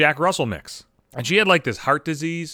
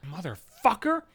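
high-pitched acted pleading with a cat; exasperated scolding; 12 semitones higher than usual. Owner woken by his hungry cat, acted exasperated scolding.

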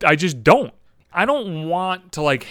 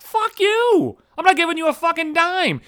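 much higher, indignant yell; furious comic rant pitched way up; angry outburst; 14 semitones higher than usual. Acted. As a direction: hurl it back at the college. Ranting back at the college.

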